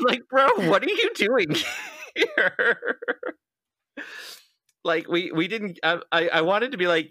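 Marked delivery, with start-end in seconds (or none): 0.3-3.0 incredulous. Retold or imitated. Retelling the date, squealing in mock disbelief. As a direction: squeal out the shock, half laughing. Mock exasperated at San Diego guy.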